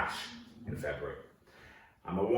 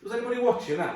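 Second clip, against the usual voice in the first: high, delighted boast; mock thrilled driver; proud excitement; 7 semitones higher than usual. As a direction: gush over a perfect parking job. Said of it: "and go".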